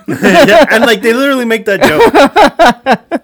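loud, high, talking through laughter; laughing hard. Real, not acted. Co-host cracking up at the dog joke, laughing. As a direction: crack up while getting the words out.

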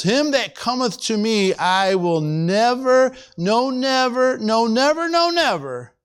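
NEVER drawn out and pitched high, hammered with each repeat; emphatic repetition. Shown as a demonstration. Preacher stressing the Greek double negative to the congregation, emphatic repetition. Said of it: "a little hard to really emphasize it as it should be".

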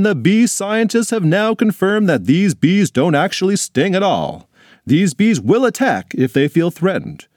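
grave 1940s war broadcast; formal newsreader breaking a bulletin; news bulletin. Acted. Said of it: "heard the following".

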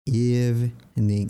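EVENING said slowly as "EEV-ning"; drawn-out EVENING with no middle E; silent middle E. Shown as a demonstration. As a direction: split EVENING in two, EEV, then NING. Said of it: "Se dice evening".

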